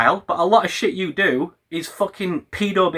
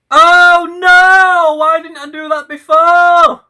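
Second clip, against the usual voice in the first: hammed-up cry, much higher and louder; mock anguish; 12 semitones higher, 8 dB louder, slower than usual. Real, not acted. Filming himself, hammed-up anguish at missing it. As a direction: yelp in exaggerated despair. Mock dismay at his own setup.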